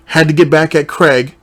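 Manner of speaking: ordinary delivery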